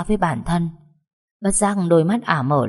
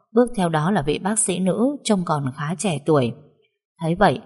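ordinary delivery, nothing marked

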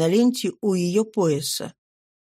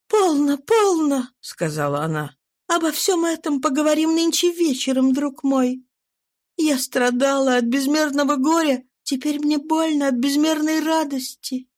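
higher woman's voice, worried and searching; pleading wife; 6 semitones higher than usual. A narrator's reading. Wife questions her changed husband, higher worried voice. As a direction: plead gently as an anxious wife.

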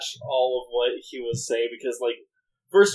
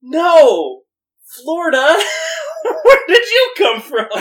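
high, loud, laughing exclamation; laughing disbelief; 8 dB louder than usual. Retold or imitated. Narrator recalling her reaction, laughing in disbelief. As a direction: cry it out through laughter. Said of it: "I was just sitting there like".

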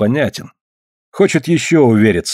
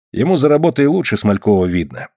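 ordinary delivery, nothing marked

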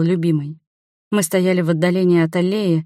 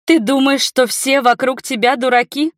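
high, loud indignant challenge; furious; 6 semitones higher, 3 dB louder than usual. A narrator's reading. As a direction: accuse her in a rage. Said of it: "я была в бешенстве".

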